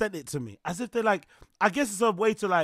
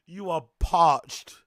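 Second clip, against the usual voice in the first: slow; 5 dB louder, much slower than usual. Real, not acted. Slow punchline.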